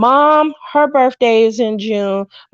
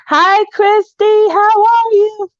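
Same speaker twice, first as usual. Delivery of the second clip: much higher, slow, sing-song hello; sweet high greeting; 11 semitones higher, 3 dB louder, slower than usual. Real, not acted. Greeting a viewer named in the live chat, high and sing-song.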